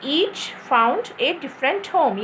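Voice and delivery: slow, measured "Each found a different home"; model reading. Shown as a demonstration. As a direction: read "Each found a different home" slowly as one block. Teacher models student's line correctly. (धीरे, साफ़ पढ़कर दिखाना)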